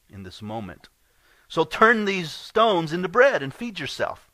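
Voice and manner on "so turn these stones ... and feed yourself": higher, louder taunting tempter; tempting Satan. Acted. Satan tempting the hungry Jesus, higher and louder taunt. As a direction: dangle the offer like the devil would.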